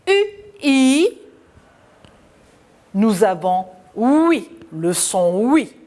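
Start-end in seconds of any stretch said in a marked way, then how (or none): 0.1-1.1 U, then I, the I higher and louder; name each vowel apart, U, I; letters one by one. Shown as a demonstration. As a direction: spell out U, I slowly. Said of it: "Nous associons".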